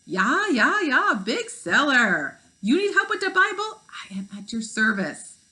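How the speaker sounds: a bad, booming German impression; attempted German accent. Gutenberg offers the kids help, hammed-up German accent. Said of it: "That's supposed to be German".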